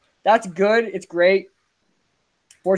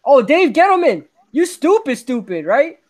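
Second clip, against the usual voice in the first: higher, louder yell of "stupid"; exasperated yell; 6 semitones higher, 5 dB louder than usual. Real, not acted. Fan yelling at Dave Gettleman over the trade, exasperated. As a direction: shout your frustration at the GM.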